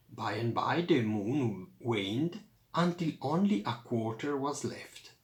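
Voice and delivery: plain reading voice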